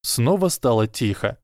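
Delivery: ordinary delivery, nothing marked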